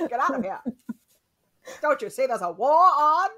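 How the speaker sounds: put-on high, loud old-timey newsreel voice; 1950s announcer. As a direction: bellow it like a fifties announcer. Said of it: "nineteen fifties announcers".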